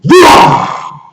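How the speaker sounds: a long, slow roar; lion roar. A narrator's reading. Storyteller roaring as the lion, loud and slow. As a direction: roar like the king of beasts.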